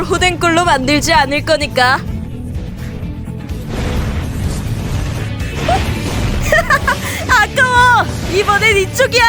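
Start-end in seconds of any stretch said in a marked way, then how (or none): 2.0-7.2 high, airy creepy laugh; eerie breathy giggle. Acted. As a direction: giggle like a delighted monster. Titan laughs.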